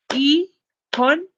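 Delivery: "Economist" split into high, loud separate syllables. Shown as a demonstration. Teacher shows the syllable stress, broken-up "Economist". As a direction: punch out "Economist" beat by beat. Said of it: "stress on the second syllable".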